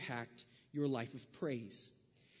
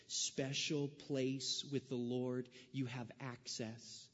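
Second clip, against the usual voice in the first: slow, lowered earnest sermon voice; slow preaching; 5 semitones lower, 4 dB quieter, slower than usual. Acted. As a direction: draw it out, earnestly. Slow intense preaching passage.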